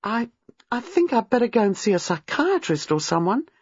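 a touch of nervous agitation; agitated young woman. A narrator's reading. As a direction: stumble into it, troubled and high. Distressed woman fears for her sanity.

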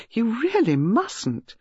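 high, drawn-out amused scolding; lightly scandalised reproof; half laughing, half angry. A narrator's reading. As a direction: protest slowly, torn between amusement and reproach. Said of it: "half laughing and half angry".

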